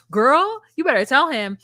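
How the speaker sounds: high, loud hype cheer; Girl, loud and high, cheering her on; egging her on. Retold or imitated. Cheering on the daughter.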